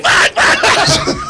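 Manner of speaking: high, breathless cursing through laughter; panicked laugh-curse; laughing yelp. Retold or imitated. Driver cursing as the trooper flies past. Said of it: "I'm like".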